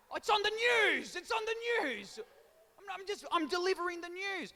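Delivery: hammed-up pitchy protest to the crowd; mock indignant outburst. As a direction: throw it up high like a wounded defence.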